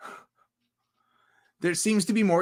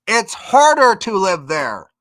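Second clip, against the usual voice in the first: loud, higher, hammering on "harder"; emphatic insistence; 4 semitones higher, 8 dB louder, slower than usual. Real, not acted. Explaining why the country is better, loud emphatic insistence. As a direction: drive the point home loudly. Hammering home his rhetorical point.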